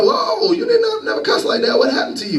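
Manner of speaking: high-pitched startled whoa, then a laugh; shocked exclamation. Retold or imitated. Friend reacting in shock to her cussing, high and startled. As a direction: act stunned at a friend's new language. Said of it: "And you're like".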